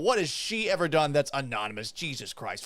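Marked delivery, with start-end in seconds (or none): none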